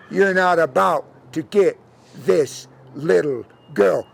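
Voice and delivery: slow, deliberate defiance; defiant defender. Acted. Defender facing down the devil, slow and deliberate. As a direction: space the words out firmly.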